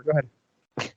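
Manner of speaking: talking through buck teeth; buck tooth voice. Pretend. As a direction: say it with teeth pushed over the lip. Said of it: "I was doing the buck tooth thing".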